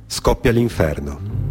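normal talking voice

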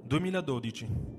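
ordinary talk

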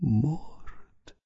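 soft, drawn-out stunned murmur; odd hushed echo of the news; strange murmur. A narrator's reading. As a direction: murmur the word slowly, barely aloud. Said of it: "با صدای عجیبی زمزمه کرد".

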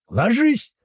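high, explosive warning cry; frantic yell. A narrator's reading. Narrator warning comrades of grenades, frantic high yell. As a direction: yell them down to the ground. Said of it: "ору не своим голосом".

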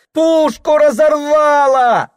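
put-on high, loud boy's cry; shouting. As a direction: cry out in alarm like a boy. Boy shouts the news.

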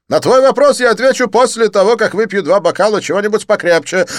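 high, loud, theatrical French friend; excited Didier. Acted. Didier greeting his friend Lin, high excited gush.

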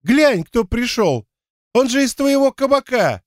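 high, gleeful exclamation; delighted, high-pitched recognition; happily excited. A narrator's reading. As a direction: announce it high, with a big happy smile. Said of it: "расплылся в счастливой улыбке Игорь".

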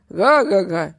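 loud booming ha-ha-ha; hearty guffaw. A narrator's reading. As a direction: laugh big at your own joke. Laughs at his own pun.

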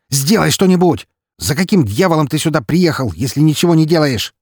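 громко и высоко, с яростью; обрушься с упрёком; гневное требование. Acted. Лазарев наплевав на правила. (Лазарев в гневе требует от Распутина)

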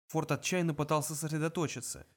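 ordinary delivery, nothing marked

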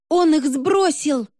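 high, drawn-out squeal of alarm; frightened shriek. A narrator's reading. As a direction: shriek it out slowly, pitch way up. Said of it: "заверещала я".

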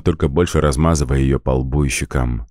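ordinary delivery, nothing marked

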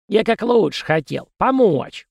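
high, whiny stuffy-nose voice; nasal whine. Acted. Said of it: "прогнусавил он". (гнусавое нытьё)

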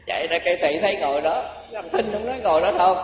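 normal talking voice

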